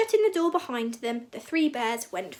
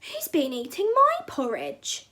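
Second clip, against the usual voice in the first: medium-sized bear voice, higher and slow; Mama Bear; 5 semitones higher, slower than usual. A narrator's reading. Mama Bear finds her porridge eaten, medium-sized cry. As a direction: cry out moderately, pitched up, not roaring. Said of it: "cried Mama Bear in a medium-sized voice".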